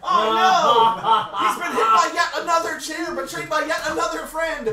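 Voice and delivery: high, laughing play-by-play call; hammed-up ringside commentary; wrestling commentator.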